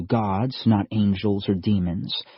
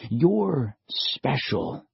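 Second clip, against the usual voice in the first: slow and gentle; 3 semitones higher, much slower than usual. A narrator's reading. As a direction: tell her gently, drawing it out. Old man, slow and soft.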